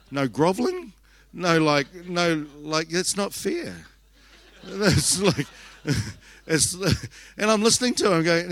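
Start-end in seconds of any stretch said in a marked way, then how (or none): 0.1-3.7 high-pitched comic protest; mock indignation. Mother protesting that her son skipped the groveling, mock outrage. 3.8-6.7 real laugh breaking into the words. Real, not acted. Storyteller amused by her own jealous reaction, laughing.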